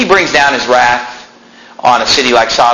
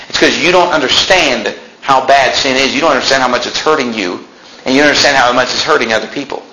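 usual speaking voice

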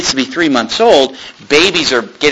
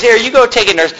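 higher, breezy hand-off; offhand Pharaoh's daughter; 9 semitones higher than usual. Retold or imitated. Preacher voices Pharaoh's daughter dismissing the nurse. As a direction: shoo the nurse away casually. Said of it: "And so she says".

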